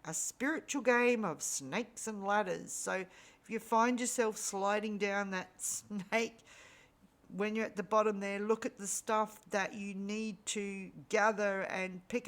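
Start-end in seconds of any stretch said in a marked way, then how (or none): none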